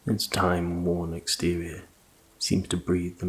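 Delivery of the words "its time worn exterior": usual speaking voice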